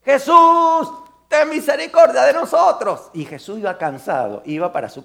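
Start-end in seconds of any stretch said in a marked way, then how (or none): 0.0-3.1 loud, high pleading cry to Jesus; desperate shout. Acted. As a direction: call out with all your voice, begging. Blind men shouting to Jesus.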